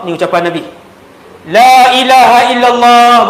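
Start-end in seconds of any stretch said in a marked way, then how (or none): 1.5-3.3 loud, slow and drawn out. Shown as a demonstration. Preacher showing his loud version to the congregation, loud and slow. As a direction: proclaim it to the back of the hall. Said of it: "ni saya sebut kuat".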